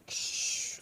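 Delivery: soft unvoiced suction whoosh; sucked back into his body, soft breathy whoosh; whoosh sound. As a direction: imitate a vacuum pulling in.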